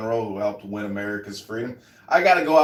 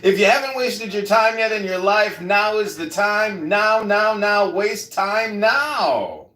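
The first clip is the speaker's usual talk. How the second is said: higher and louder, a hammed-up show host, slowing on the titles; mock announcer intro; 8 semitones higher, 3 dB louder than usual. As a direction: boom out the show's intro like a TV announcer.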